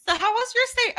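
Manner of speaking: high, polite service voice; hotel staff. Retold or imitated. Staff at checkout asking the guest, high and polite. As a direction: ask it like a chirpy clerk. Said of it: "they were like".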